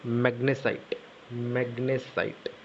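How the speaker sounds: drawn out slowly; slow dictation. Shown as a demonstration. Teacher dictating magnesite to students, slowly. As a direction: draw the word out so the class can copy it.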